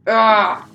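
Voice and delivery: sudden loud yelp; startled yelp. Real, not acted. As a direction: yelp in surprise. Attacked in game.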